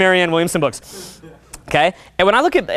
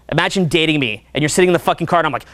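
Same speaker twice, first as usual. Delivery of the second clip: ordinary talk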